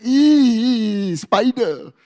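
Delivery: mock "eek" at a spider; imitated squeamish squeal. Speaker mimics audience already reacting to spider, squeamish squeal. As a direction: squeal like a squeamish listener, half in play. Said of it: "some of you already going like".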